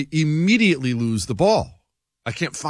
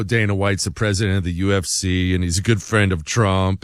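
usual speaking voice

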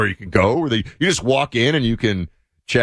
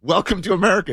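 high, hearty welcome; mock proud local; 11 semitones higher than usual. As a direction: greet like a proud American. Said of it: "It's like".

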